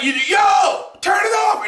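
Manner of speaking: high, pushed shout at the screen; mock yell. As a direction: order him to turn it off. Yelling at character.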